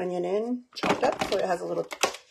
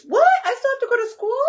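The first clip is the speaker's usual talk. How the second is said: much higher, a mock whine of disbelief; whiny kid; 7 dB louder than usual. Mom imitating her son's outrage that it didn't snow, high mock whine. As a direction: imitate a kid outraged that school still happens. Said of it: "'Cause you were like".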